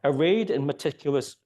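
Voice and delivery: ordinary talk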